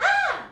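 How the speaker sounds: loud high playful shriek; intentional scream back. Retold or imitated. Answering her sister's scream as a running joke, a loud playful shriek. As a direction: scream back on purpose, full volume. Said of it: "so I'd scream back intentionally".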